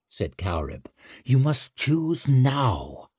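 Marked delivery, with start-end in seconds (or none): 1.3-3.1 slow solemn witch. Acted. Witch urges slowly.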